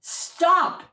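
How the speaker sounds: high, loud stop; deafening shout. Acted. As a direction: bellow it to halt everyone. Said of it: "in a deafening voice".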